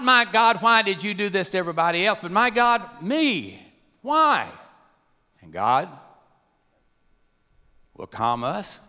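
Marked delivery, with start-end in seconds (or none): 0.0-2.2 high, raised plea; acted protest to God. Acting out a prayer of protest. 2.3-4.5 high, raised cry of protest; pleading outcry in a preacher's voice; acted plea to God. Acting out a personal protest to God.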